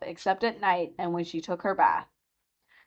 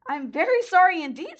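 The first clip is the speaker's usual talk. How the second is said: put-on frightened girl; 11 semitones higher than usual. A narrator's reading. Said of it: "truly frightened".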